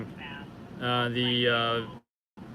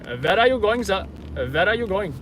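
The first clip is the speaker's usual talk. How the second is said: put-on raised, accented cabbie voice; cab driver imitation; 5 semitones higher, 4 dB louder than usual. Yellow cab driver to a customer through the window, imitated accent. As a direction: play a cabbie questioning a would-be passenger. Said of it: "will be like, wind down the window".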